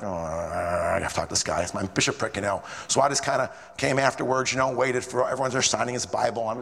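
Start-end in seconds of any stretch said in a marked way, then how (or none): 0.0-2.4 inner thought. Retold or imitated. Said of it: "I'm like".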